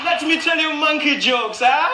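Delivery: high, slow, put-on stage voice; hammed-up, drawn-out show caller; caricatured African entertainer. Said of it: "sound like a guy in leopard skin".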